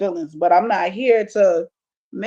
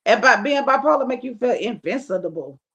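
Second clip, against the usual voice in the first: normal talking voice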